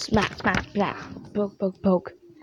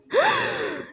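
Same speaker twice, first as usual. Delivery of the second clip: high shocked gasp; 4 dB quieter than usual. Acted. Character gasps in shock.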